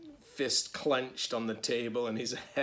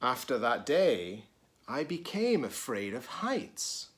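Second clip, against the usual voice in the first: put-on reading to children; 3 semitones higher, 4 dB louder than usual. A narrator's reading. Teacher reading a picture book to children, lively. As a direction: read it brightly to the kids.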